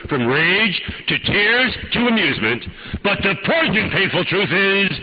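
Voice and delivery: high, driving sermon at full force; impassioned preaching. Real, not acted. Preacher confronting listeners over not trusting God, impassioned preaching.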